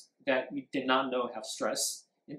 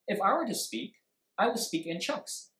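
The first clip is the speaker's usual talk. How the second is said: two chunks, break after "speak", raised pitch; 7 semitones higher than usual. Shown as a demonstration. Teacher showing chunked speech, two groups split after "speak". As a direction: run words together up to "speak", pause, then the rest. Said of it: "Two, right?".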